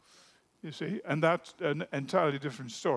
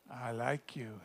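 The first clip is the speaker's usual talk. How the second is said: shy murmur; 6 semitones lower, 10 dB quieter than usual. Acted. Shy boy repeats to girl.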